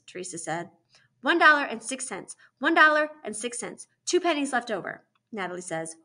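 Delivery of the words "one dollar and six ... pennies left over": higher, louder recitation of figures; lightly voiced stubborn insistence; insistent repeating. A narrator's reading. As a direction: repeat it in the same set tune. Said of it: "like someone is arguing with her math".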